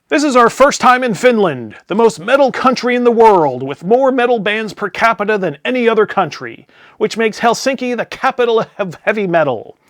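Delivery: high, loud stage-announcer voice; hammed-up frontman hyping the crowd; band singer's stage announcement. Swedish singer addresses the Finnish crowd, loud and high. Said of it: "imagine me reading it in the voice of the Swedish Chef".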